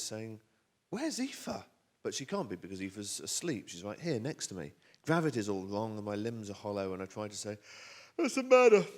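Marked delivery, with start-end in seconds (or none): 0.9-1.6 much higher-pitched Holly; high-pitched questioning wife; voicing Holly. Holly asks after missing daughter. 8.2-8.8 high, loud, drawn-up question; straining to be heard; strained call. Acted. Struggles to speak to Holly.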